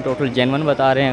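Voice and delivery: normal talking voice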